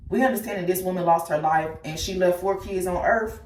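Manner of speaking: usual speaking voice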